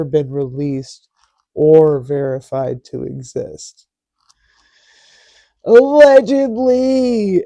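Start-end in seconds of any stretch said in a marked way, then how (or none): 5.6-7.5 drawn-out, high and loud "allegedly"; loud sarcastic. Acted. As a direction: belt it out like a mock disclaimer. Sarcastic legal disclaimer shout.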